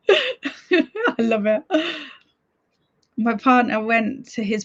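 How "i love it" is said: slow words through laughter; laughing. Real, not acted. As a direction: laugh while saying it. Laughing at chat.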